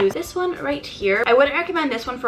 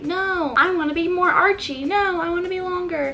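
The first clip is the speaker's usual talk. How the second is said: higher whiny squabbling voice; bickering brows; 7 semitones higher than usual. Retold or imitated. Vlogger voicing her eyebrows bickering, higher and whiny. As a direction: voice a bratty eyebrow arguing. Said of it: "they're always arguing with each other".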